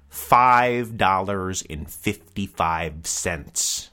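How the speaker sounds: very slow, each word spaced out; five dollars and fifty-five cents, drawn out slowly; slow emphatic price. Acted. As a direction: drag out the price for effect. Announcing the cheap price.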